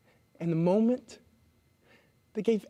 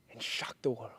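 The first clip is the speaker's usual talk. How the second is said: hushed dramatic whisper; 11 semitones lower, 11 dB quieter than usual. Acted. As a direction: breathe it out, barely voiced. Dramatic whispered promo line.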